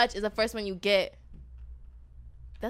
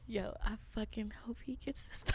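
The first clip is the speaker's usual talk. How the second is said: whispering; 12 dB quieter than usual. Real, not acted. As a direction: whisper it with glee. Conspiratorial whispered hope.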